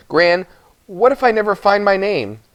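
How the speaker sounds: put-on high, pleading boy's voice; boy's anxious plea. Boy asking his Gran about his lost name, anxious. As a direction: ask it like a worried child.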